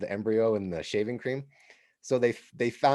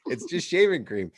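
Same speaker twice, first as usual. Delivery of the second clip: mock exasperated; 8 semitones higher, 4 dB louder than usual. Exasperated young man protesting.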